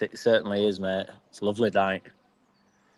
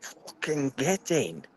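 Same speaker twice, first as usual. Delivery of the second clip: slow, drawn-out and higher, savoured; quiet, delighted cheer at a cheap pint; gleeful get in; 5 semitones higher, 6 dB quieter, much slower than usual. Retold or imitated. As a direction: stretch it out like you've found a bargain. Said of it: "and I was like".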